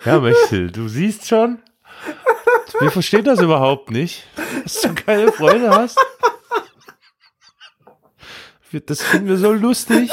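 high, giggly fake comfort; laughing mock sympathy. Real, not acted. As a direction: console her while cracking up.

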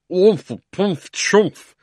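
muffled under a hat. A narrator's reading. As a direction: grunt through a crushed hat. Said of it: "voice from inside the hat".

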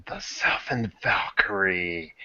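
low, slow, hushed name reveal; real cool. Shown as a demonstration. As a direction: say it slow and soft, ultra cool. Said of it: "I gotta say that, like, real cool".

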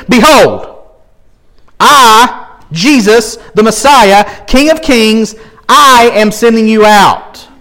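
preaching the verse at full force; proclaiming Jesus. Acted. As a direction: announce it like a king's decree.

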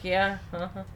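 ordinary talk, nothing marked

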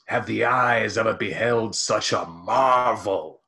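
slow, grand theatrical Roman. Acted. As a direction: declaim it like an ancient Roman. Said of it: "you spoke in your pirate voice".

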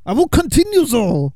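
put-on high, hammy French accent. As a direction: keep up the silly French voice. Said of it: "doing the French accent".

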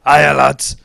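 a loud, bright mock greeting from a stranger; imitating woman. Woman greeting lads at the pub, mock loud. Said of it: "this woman comes along and says".

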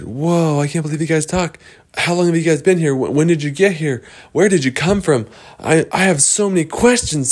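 high, excited stream of questions; amazed and curious. Acted. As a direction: pile up the questions in high-pitched wonder. Wendy amazed, firing questions.

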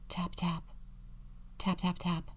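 tapping sound effect. A narrator's reading. Narrator imitates soft knocking.

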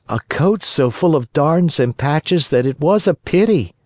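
high-pitched little puppet voice; high, childlike and earnest; earnest puppet boy. Acted. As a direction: pitch it high, plead your father's case. Voices Pinocchio in the story.

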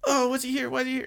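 gasp, then very high flustered voice; Karen Tendo. Retold or imitated. Girl at her desk hearing her crush, high startled squeak. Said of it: "Karen Tendo sitting at her desk go".

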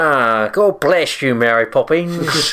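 hammed-up mock Cockney, drawn out, high and loud; slow, exaggerated bad Cockney impression. Co-host mimicking a famously bad film accent, hammed-up Cockney.

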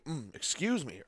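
choking. Real, not acted. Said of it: "I was choking on nothing there".

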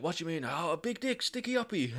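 high, soft barmaid in mock broken-English accent; unplaceable foreign accent. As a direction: imitate a puzzled barmaid, vague foreign accent. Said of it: "I don't know what accent she had".

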